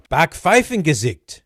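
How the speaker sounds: Backpfeifengesicht said loud and slow in a hammed-up faux German accent. Shown as a demonstration. Host relishing a German word for Netflix, mock German accent. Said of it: "With my faux German accent".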